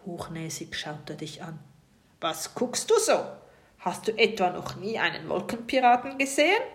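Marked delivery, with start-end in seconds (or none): 2.2-6.6 lauter, hochnäsiger Pirat; leicht verstellt, von oben herab; brummige Stimme. A narrator's reading. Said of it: "fragt er mit seiner brummigen Stimme". (Pirat blafft das staunende Kind hochnäsig an)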